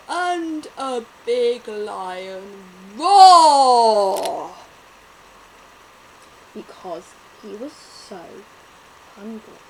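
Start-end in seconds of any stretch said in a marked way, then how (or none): 0.1-4.5 slow, loud roaring lion. Acted. Lion wakes hungry, roars loud and slow. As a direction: roar it out big and slow.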